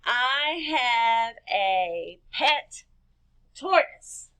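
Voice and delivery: very slow, each word drawn out; slow dictation for children; stretched dictation. Shown as a demonstration. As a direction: say it slowly as if writing every word down. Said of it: "Let's count and see how many words".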